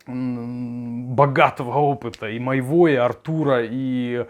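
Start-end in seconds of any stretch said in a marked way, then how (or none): none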